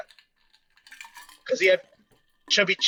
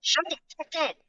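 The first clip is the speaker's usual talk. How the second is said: mock bossy; 9 semitones higher, 5 dB quieter than usual. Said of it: "he's a lot more, more no, no bullshit".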